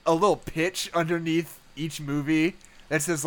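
ordinary talk, nothing marked

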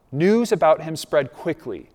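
normal talking voice

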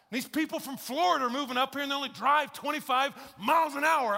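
high, loud grumbling about the town; put-on indignant complaint; mock complaining. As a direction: air grievances loudly, pitched well up. Voicing townspeople complaining about change.

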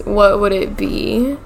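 normal talking voice

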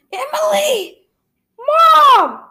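high desperate shout; a shrieked call for help. Acted. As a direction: cry out for her mother. Calling out in danger.

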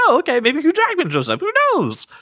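very high, breezy self-quote; open-minded musing. Retold or imitated. Host recalling his reaction to casting rumors, high-pitched musing. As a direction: replay your own 'why not' in a high voice. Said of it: "I was like".

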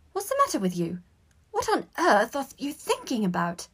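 mildly cross telling-off; snapping. A narrator's reading. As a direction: demand an answer, exasperated. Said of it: "snapped Hermione".

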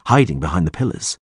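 ordinary delivery, nothing marked

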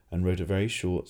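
usual speaking voice

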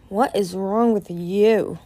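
slow, haughty question; cool, arrogant. A narrator's reading. Winter to Moon from above her, cool and arrogant. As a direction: draw it out with cool disdain. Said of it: "a cool, arrogant voice said".

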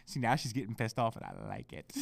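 low, soft, amused confiding; gloating aside. Real, not acted. Podcaster confiding to listeners about annoyed fiancée, quiet gloating. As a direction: drop right down and relish it.

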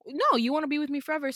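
high, loud, fast mocking of A; insistent mock retort. Voicing A's insistent reply.

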